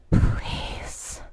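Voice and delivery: breathless pleading whisper; gasped whisper. Acted. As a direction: gasp the word out, begging. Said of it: "I gasp".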